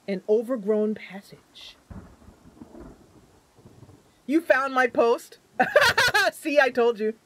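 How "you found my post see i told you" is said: laughing. Real, not acted. Laughing at fan finding post.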